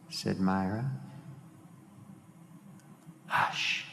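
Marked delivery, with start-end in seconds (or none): 3.3-3.9 voiceless, whispered hush. A narrator's reading. Myra and Mark sitting listening, whispered hush. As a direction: shush them under your breath. Character shushes the other.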